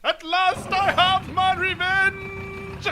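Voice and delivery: slow, gloating villain voice. Acted. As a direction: savor every word like a villain.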